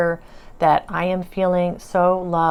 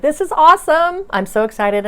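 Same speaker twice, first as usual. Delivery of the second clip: much higher and louder, awesome squealed; thrilled; 10 semitones higher, 7 dB louder than usual. Retold or imitated. Answering her spirit guides, squealing with delight. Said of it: "I said".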